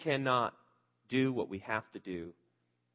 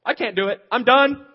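loud, high mock-despair wail; despairing outburst; 12 semitones higher, 16 dB louder than usual. Preacher voicing his own despair, loud high wail. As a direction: cry it out like someone at the end of their rope. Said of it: "it's like".

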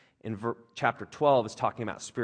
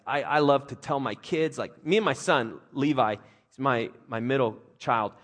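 ordinary talk

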